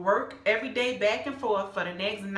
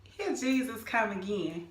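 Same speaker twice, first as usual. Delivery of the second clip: normal talking voice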